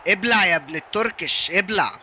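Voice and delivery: loud, gloating taunt at the rival; gloating shout. Real, not acted. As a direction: rub it in, loud. Gloating after scoring.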